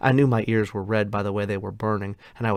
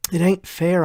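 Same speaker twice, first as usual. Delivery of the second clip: loud, high, anguished protest; passionate cry; 9 semitones higher, 7 dB louder than usual. A narrator's reading. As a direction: cry it out at the injustice. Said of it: "I cried passionately".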